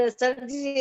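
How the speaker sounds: normal talking voice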